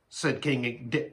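ordinary delivery

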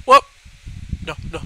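ordinary talk

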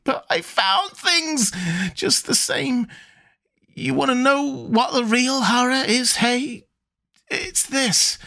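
put-on high, near-screaming old sailor; ranting half-mad old man; frenzied old man. A narrator's reading. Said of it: "Zadok was nearly screaming now".

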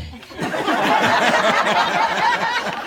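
high, loud burst of laughter; big loud laugh. Real, not acted. Laughing with the mourners at her antics.

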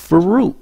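fruit cut short, breath held at the end; shortened ending. Shown as a demonstration. Teacher shows learners to hold the breath, shortened ending. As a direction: say fruit high, stopping the final t. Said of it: "Rút ngắn lại".

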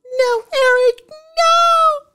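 high, loud outburst at the screen; piercing fangirl "no"; excited scream. Real, not acted. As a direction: scream it in overwhelmed protest. Screams at trailer in reaction.

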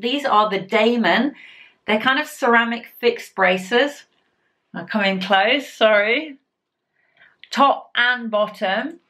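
speaking with stretched lips; lips pulled apart by fingers. Real, not acted. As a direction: fingers hooked in mouth corners. Seen 0: Both index fingers pull lips apart showing braces while she speaks.

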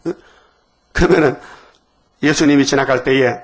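usual speaking voice

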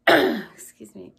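hoarse after clearing throat; dusty throat, coughing it clear; coughing on dust. Real, not acted. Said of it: "Got some dust in my throat".

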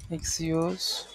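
ordinary talk, nothing marked

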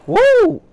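a huge high, loud woo; sky-high whoop of joy. Retold or imitated. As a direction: let out a wild party whoop. Friend whoops about toast.

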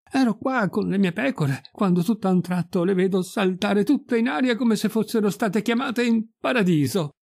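high-pitched, animated telling; breathless astonished account. Acted. Pirolo explaining the vanished sheep to the priest, animated and amazed. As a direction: tell it as an amazed eyewitness.

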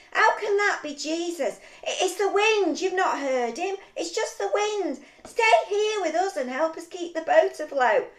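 urgent scolding. Acted. Friends warning Peter not to leave the boat, urgent scolding. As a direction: talk him out of it, loud and insistent.

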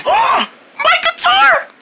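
very high, slow squeal; pretend anguish. Owner seeing the guitar broken, high anguished squeal. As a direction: squeak out a drawn-out cartoon lament.

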